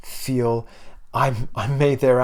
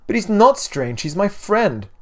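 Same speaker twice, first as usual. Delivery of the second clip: put-on higher, louder indignant boy; pleading, pitched-up objection; protesting child; 9 semitones higher, 5 dB louder than usual. A narrator's reading. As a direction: protest to mother in defence of a friend. Boy protests his mother's scolding.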